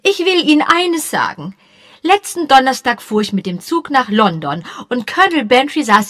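high, loud domineering widow; imperious widow lecturing the vicar; bossy widow. Acted. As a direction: insist on her suspicion.

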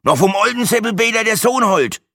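put-on high, loud, grudging Franconian old man; grumpy grumble. Old man answers Brenda grudgingly in Franconian. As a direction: snap it back unwillingly. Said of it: "raunzte es unwillig zurück".

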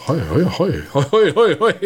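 rhythmisch wiederholtes „Heu“, immer höher; Fastnachtsruf. Pretend. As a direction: ruf es spielerisch wie einen Narrenruf. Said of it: "Kann man als Fastnachtsruf machen". (wie ein Fastnachtsruf)

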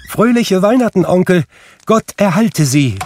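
bright, high, loud Christmas call; cheerful greeting. A narrator's reading. Nephew bursts in cheerfully greeting his uncle, high and loud. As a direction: burst in with a joyful hello. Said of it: "rief da eine heitere Stimme".

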